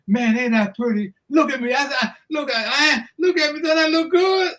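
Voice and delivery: high, playful boast; the champ admiring himself for his visitors; preening champ. Retold or imitated. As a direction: show off like a vain boxer. Ali preening before them.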